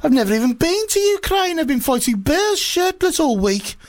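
very high, indignant comic dictator; Putin. Acted. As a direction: protest innocence in a squeaky-high put-on voice. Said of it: "Okay, I'll be Putin then".

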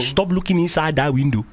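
put-on stern Nigerian accent. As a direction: order him in a Nigerian accent. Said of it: "in a Nigerian accent".

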